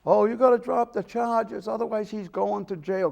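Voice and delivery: imitating a crying mother begging; mock crying mother. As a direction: retell the mother's tearful plea. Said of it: "And the mother starts crying".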